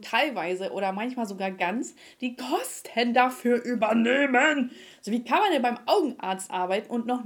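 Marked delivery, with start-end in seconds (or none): 2.2-4.7 slow, louder exasperated hammering; genervte Tirade. Retold or imitated. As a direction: drag out each word in exasperation. Exasperated rant at the receptionist. (genervt, laut und gedehnt)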